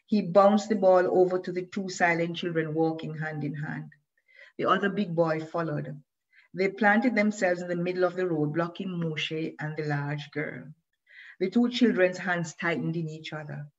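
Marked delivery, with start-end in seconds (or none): none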